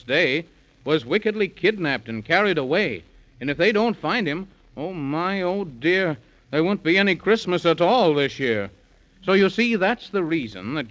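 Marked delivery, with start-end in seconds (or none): none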